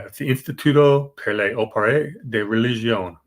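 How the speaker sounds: light Italian accent on the Italian name. Shown as a demonstration. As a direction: roll out the Italian words in an Italian accent. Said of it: "That's my Italian accent".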